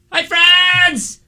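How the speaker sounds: high, slow, exaggerated greeting; through a window. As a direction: wave and call through the glass. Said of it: "they're talking through a window right now".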